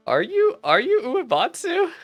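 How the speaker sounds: ordinary delivery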